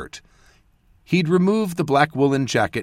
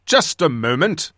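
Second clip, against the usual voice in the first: bellowed; 4 semitones higher, 4 dB louder than usual. A narrator's reading. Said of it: "Basil bellowed".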